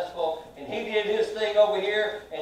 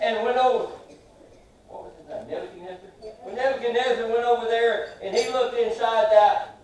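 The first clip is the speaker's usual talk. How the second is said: ordinary delivery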